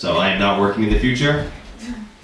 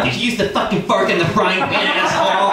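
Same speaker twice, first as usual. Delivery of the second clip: put-on accusing girlfriend; 7 semitones higher than usual. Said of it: "My girl".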